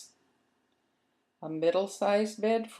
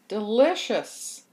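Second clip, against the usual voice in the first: Delicious drawn out with pleasure; slow, relishing delight; 3 semitones higher, 6 dB louder, slower than usual. Acted. Cat relishing the cheese, slow and delighted. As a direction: savour the word slowly, louder.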